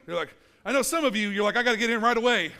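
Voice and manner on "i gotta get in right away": loud, eager jumper; gung-ho and punched up. Acted. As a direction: blurt it out, raring to dive in. Acting an eager pool jumper.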